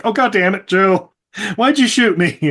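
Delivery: imitated aggrieved griping; mock indignation. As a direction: complain in mock annoyance. Retold complaint on waking up shot.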